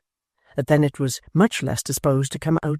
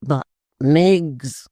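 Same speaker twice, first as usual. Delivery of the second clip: imploring; 5 dB louder than usual. Acted. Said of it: "cried Mister Tappertit".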